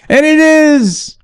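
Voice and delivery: high, slow triumphant exclaim; high, drawn-out triumphant; excited. Real, not acted. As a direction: announce it like a win. Excited confirmation.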